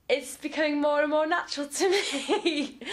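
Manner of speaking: laughing through the words. Real, not acted. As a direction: breaks into a laugh mid-sentence. Laughs while talking about embarrassment.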